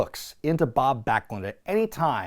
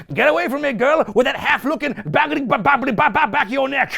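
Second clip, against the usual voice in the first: higher hammed babble; Albano impression; 9 semitones higher than usual. Imitating Albano mocking the snake lady, higher hammed voice. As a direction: stumble over the words mockingly. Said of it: "He's going".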